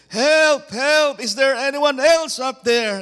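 high, loud cry for help; comic desperate yell. Acted. Hiker rejects God's advice and calls for someone else, desperate yelling. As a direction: holler up for someone, anyone.

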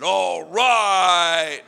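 loud drawn-out "All right" from a seventh grader; kid's cheer. Retold or imitated. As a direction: cheer it from the back row. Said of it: "One seventh grader in the back said".

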